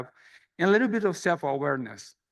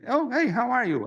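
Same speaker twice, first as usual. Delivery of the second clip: higher, overly bright hello hiding that he forgot her name; fake cheery greeting; 7 semitones higher than usual. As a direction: sound delighted to see someone you can't place. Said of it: "I couldn't remember her name".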